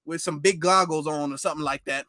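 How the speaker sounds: normal talking voice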